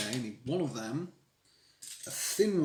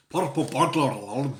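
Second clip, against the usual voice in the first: "purple burglar alarm" in a broad mock Scottish accent, louder; 7 dB louder than usual. Streamer jokes to chat, trying the tongue twister in a mock Scottish accent. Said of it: "If you do it as a Scottish accent".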